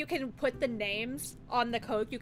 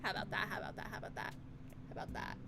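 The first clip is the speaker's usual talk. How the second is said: softer and softer, trailing to a murmur; fading repetition; 4 semitones lower, 11 dB quieter, much faster than usual. Pretend. Streamer repeating a phrase, fading to a murmur. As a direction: repeat it until it dies out.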